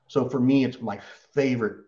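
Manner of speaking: ordinary talk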